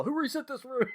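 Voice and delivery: high-pitched yell held down in volume; mock yell. Host freaking out at staff over the room, high mock yell. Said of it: "he, like, yelled at people".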